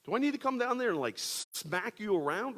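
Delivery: shrieking high "What?" then a loud scolding threat; mock outrage down the phone; outraged friend. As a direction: play the friend exploding at him. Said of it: "I thought he'd be like".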